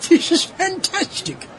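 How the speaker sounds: laughing mockery. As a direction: laugh while taunting. Mocking robot wife, laughing.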